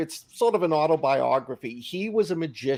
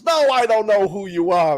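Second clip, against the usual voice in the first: a mock furious shout, louder and higher; retold yell; 4 semitones higher, 5 dB louder than usual. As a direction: Ike loses his temper, hammed up a little. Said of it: "starts yelling and screaming and swearing".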